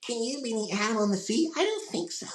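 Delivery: very high-pitched kid cartoon impression; Beast Boy. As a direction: push the voice way up into a squeaky cartoon register. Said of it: "I can't do Beast Boy anymore because my voice got too deep".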